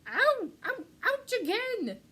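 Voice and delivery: loud, high cries of pain; pained yelps. A narrator's reading. Pack rat caught in cactus, loud high yelps. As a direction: yelp each time a prick hits.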